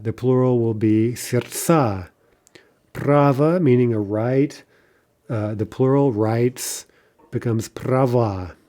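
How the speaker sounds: ordinary delivery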